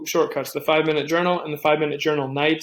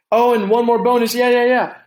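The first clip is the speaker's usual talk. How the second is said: suddenly remembering; 7 semitones higher, 6 dB louder than usual. Real, not acted. Suddenly remembering a bonus tip.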